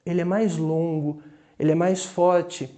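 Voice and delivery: ordinary talk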